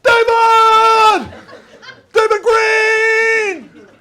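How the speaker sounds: long drawn-out shout of the name; calling out. Retold or imitated. Husband and wife shouting at a friend in the parade. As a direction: stretch the name out at full shout. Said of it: "the two of us are standing there going".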